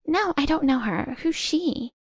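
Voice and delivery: high, feigned innocent denial; innocent-sounding Tracy. Acted. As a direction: play dumb, voice rising.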